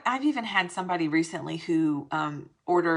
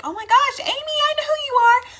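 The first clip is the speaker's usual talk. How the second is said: squealing high mock fan, much higher and louder; excited fan; 8 dB louder than usual. Stranger recognizing Amy, squealing high and louder. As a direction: gush like a stranger who spots you.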